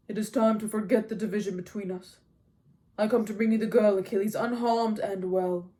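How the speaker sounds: loud, declamatory king's voice; Agamemnon, pompous commander. A narrator's reading. Agamemnon returning the girl to Achilles, loud and formal. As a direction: proclaim it as a formal peace offer.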